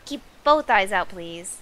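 put-on Australian accent; Aussie accent, firm ask. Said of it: "I have to give them their Aussie voice".

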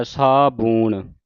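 ਸਾਬੂਣ drawn slowly, long oo, 'saa-boon'; as written. Shown as a demonstration. Teacher shows the scripture reading, ਸਾਬੂਣ with long drawn oo. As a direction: stretch both vowels of ਸਾਬੂਣ. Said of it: "ਸਾਬੂਣ ਹੀ ਕਹਿਣਾ ਉਹਨੂੰ".

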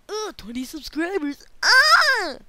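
high, loud hammed-up gasp and scream; mock excitement. Player mocking excitement over twenty subscribers, hammed-up scream. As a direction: play at freaking out over subscribers. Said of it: "it's like".

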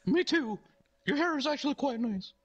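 high falsetto, anger easing off; conciliatory clown. Retold or imitated. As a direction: make up sheepishly in the clown's high voice. Ronald makes up in the story.

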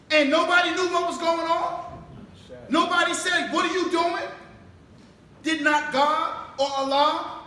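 preaching at full force. Acted. Minister challenging the congregation with rising, shouted preaching. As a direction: build the questions into a rousing shout. Preacher building to a fiery climax.

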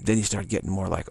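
normal talking voice

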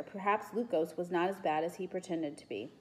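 usual speaking voice

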